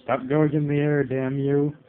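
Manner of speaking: loud frustrated yell at the screen; shouting at the game. Real, not acted. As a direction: yell it at the CPU fighter. Yelling at opponent in game.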